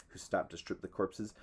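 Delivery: ordinary delivery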